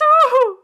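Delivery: loud, high whooping laugh; acted hoot. Sailor gossiping to his mate, loud high hoot. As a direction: let out a high, mocking hoot.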